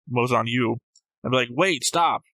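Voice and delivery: ordinary delivery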